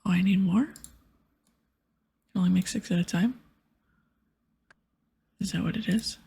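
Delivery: ordinary delivery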